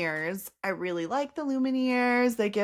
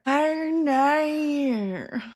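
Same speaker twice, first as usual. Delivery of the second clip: higher, drawn-out mock Australian accent; 5 semitones higher than usual. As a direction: echo it in exaggerated Australian. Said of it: "That's my Australian accent".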